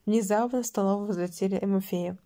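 plain reading voice

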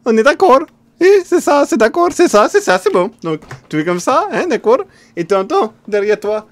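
high, loud squeaky voice breaking into laughter; high character voice, laughing. Acted. Streamer in character cracking up, high and loud. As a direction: push the silly voice high and loud.

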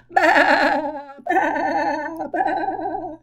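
three baas, first loud then fading; sheep bleating. Pretend. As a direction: bleat like a sheep, dying away.

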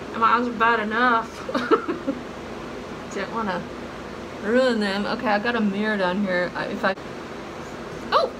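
lips stiff under wet sheet; slightly muffled mouth movement; through a sheet mask. Real, not acted. Seen 2: Wet sheet mask covers her face, mouth area included, throughout the words.

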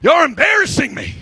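high, exasperated comic outburst; embarrassed mock scolding. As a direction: shush your showboating friends, hammed-up. Jesus scolding embarrassing disciples.

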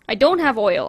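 loud dismayed realisation; surprised. Real, not acted. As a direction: catch the loss aloud. Realises oil is missing.

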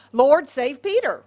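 loud, acted plea to God; fervent prayer. Christians pray for Peter's rescue, fervently. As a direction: pray it out with force.

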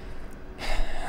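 long breathy sigh; nervous sigh. Real, not acted. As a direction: let out a long, voiceless breath. Nervous in dark forest game.

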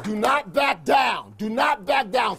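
slow, loud, hammering each word; slow rallying shout. Real, not acted. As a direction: demand they hold their ground. Locker room rally shout.

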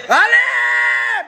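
sudden high yelled allez; shouting. Real, not acted. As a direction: yell it at the screen. Cheering at a chance.

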